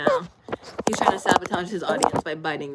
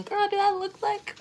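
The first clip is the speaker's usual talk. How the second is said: sassy; 13 semitones higher than usual. Real, not acted. Sassy reply to viewer.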